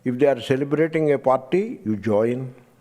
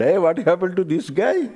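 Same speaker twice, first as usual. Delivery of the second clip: stunned; 5 semitones higher than usual. Retold or imitated. Stunned monk sees fellow crying.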